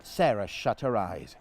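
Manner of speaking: usual speaking voice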